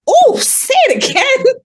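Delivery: high, drawn-out mock fascination; imitating delighted listener. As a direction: coo it high and slow, imitating a fan. Imitates listener delighted by her accent.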